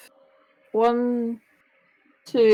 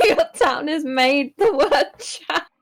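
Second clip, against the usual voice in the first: laughing through the words; giggling while teasing; 5 semitones higher, slower than usual. Real, not acted. Laughing at friend's hometown.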